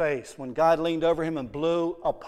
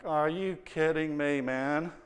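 muttered aside; 7 dB quieter, slower than usual. Retold or imitated. Visitor muttering to himself when singled out. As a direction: say it under your breath.

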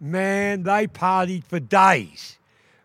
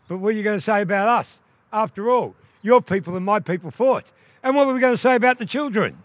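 higher, fast, anxious questions; worried water rat; 7 semitones higher, 5 dB louder, faster than usual. Acted. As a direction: rush the worries out.

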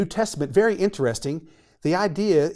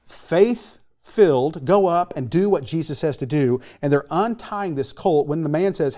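usual speaking voice

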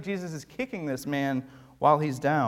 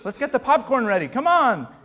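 mock-eager crowd, higher and louder; eager gawker; 6 semitones higher, 6 dB louder than usual. Pastor mimics the crowd treating Jesus like a show, eager. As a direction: play a thrill-seeker grabbing popcorn. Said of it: "people are like".

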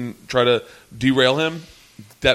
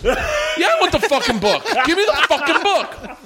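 mock exasperated demand. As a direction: demand it like a fed-up headliner. Retells Louis demanding the book.

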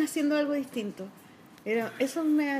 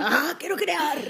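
put-on eager little child voice; excited kid; 3 semitones higher, much slower than usual. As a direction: burst out like a keen kid. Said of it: "una muy buena interpretación de mí".

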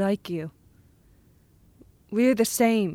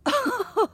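high, mocking gleeful laugh; gloating laugh; 14 semitones higher than usual. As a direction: laugh at his fear. Boy laughs at frightened man.

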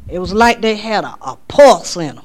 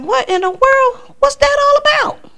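bewildered. Retold or imitated. Waking up and asking herself, high and baffled.

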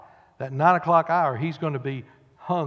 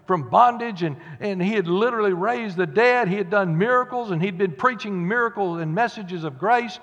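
usual speaking voice

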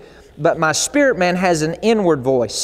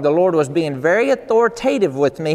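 normal talking voice

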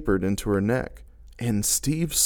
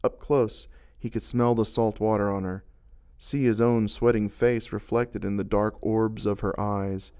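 ordinary delivery, nothing marked

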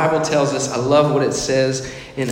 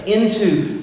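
preaching; 4 semitones higher than usual. Acted. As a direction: preach it out with full conviction. Preaching at full force.

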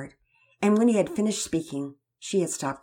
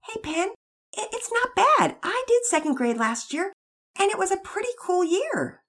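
put-on a touch higher, boyish and encouraging; lightly voiced young brother; 4 dB louder than usual. Brother to sister, lightly voiced and encouraging. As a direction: cheer up a nervous little sister. Pearson comforting his sister.

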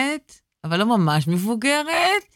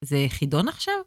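deadpan seriousness; 7 semitones lower, 7 dB quieter, faster than usual. Retold or imitated. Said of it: "בשיא הרצינות ואמר לי".

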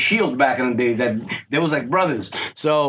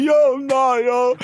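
put-on higher-pitched child's protest; imitated upset son; 7 semitones higher, much slower than usual. Dad imitating his son crying over a film, mock upset. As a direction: lightly mimic an upset little boy. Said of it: "he cried in E T".

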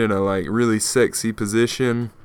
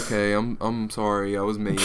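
soft, sorry and a bit lower; sheepish apology; 3 semitones lower, 7 dB quieter than usual. Retold or imitated. Apologizing to the crying kid, soft and sheepish. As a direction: apologize quietly, half-meaning it. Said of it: "I felt bad".

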